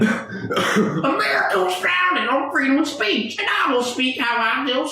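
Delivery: high, slow, hammed-up patriot voice; mocking patriot answering friend; mock patriotic. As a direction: proclaim freedom of speech like a cartoon patriot. Mock patriotic reply to partner.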